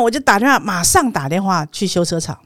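usual speaking voice

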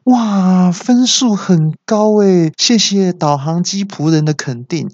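high, drawn-out wow; delighted thanks. Acted. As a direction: act thrilled and grateful. Acted delight at high score.

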